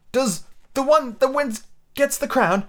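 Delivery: high, slow, halting question; out of breath. A narrator's reading. Alice asking the king as she runs, breathless high voice. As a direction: struggle to get the words out while running. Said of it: "putting her quite out of breath".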